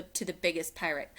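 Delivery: plain reading voice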